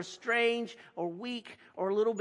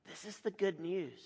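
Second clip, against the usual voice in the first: usual speaking voice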